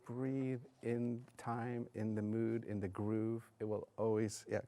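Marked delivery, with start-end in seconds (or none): 0.0-3.4 slow, softer; slow instruction. Shown as a demonstration. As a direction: stretch it out calmly. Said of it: "I want you to do that".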